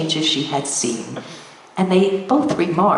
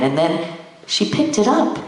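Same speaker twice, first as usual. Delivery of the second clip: ordinary delivery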